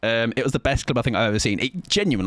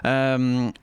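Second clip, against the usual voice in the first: usual speaking voice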